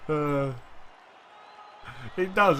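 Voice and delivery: ordinary talk, nothing marked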